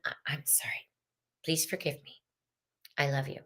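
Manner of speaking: breathy whisper of the Ho'oponopono prayer; whispered healing prayer. Shown as a demonstration.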